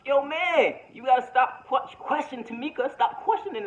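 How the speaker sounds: high-pitched, insistent gossip delivery; playful put-on voice. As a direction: pitch the voice way up, pressing the accusation. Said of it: "switching my voice around".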